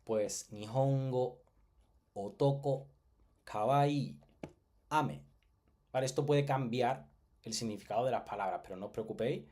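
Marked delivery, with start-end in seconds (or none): 0.5-5.2 "Nihongo, otoko, kawaii, ame" each said apart in Japanese pitch; teacher demonstrating pitch accent; pitch accent examples. Shown as a demonstration. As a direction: model the Japanese words one at a time. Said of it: "Os pongo aquí un ejemplo".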